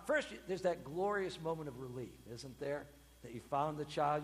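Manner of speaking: ordinary talk, nothing marked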